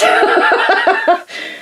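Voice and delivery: a high, bright burst of real laughter. Real, not acted. Crafter laughing at her own messy studio, high laugh. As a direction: laugh out at yourself.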